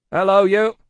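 high, loud hail. Acted. Guard hailing Jerry in the mist, loud hail. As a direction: call gruffly to a stranger.

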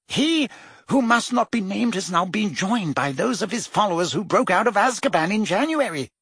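Fudge, rapid and worried. A narrator's reading. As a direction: rattle it off, anxious. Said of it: "speaking more and more rapidly".

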